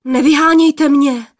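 panicked youth; startled plea. A narrator's reading. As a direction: beg in a fright not to be sent off. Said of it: "lekl se poplašený mládenec".

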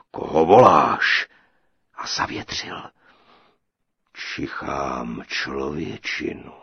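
low, slow, loud thundering giant; booming giant. A narrator's reading. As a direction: boom it out like a huge ogre. Giant sniffing. (hřmotný obr, hluboce a pomalu)

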